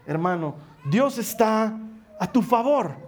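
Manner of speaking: ordinary talk, nothing marked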